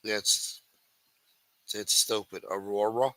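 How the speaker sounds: ordinary delivery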